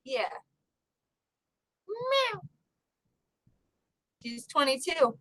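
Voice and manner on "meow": high little meow to the cat; mimicked cat meow. Owner meowing to her old cat. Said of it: "I'm mimicking her meows".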